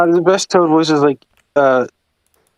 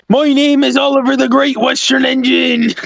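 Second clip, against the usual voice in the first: engine with a hammed-up gunslinger voice, high and strained; Oliver as John Marston; 12 semitones higher than usual. Oliver introducing himself like a Red Dead gunslinger, high hammed-up voice. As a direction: proclaim the name high, like an overblown western hero. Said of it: "I would have Oliver sound like that guy".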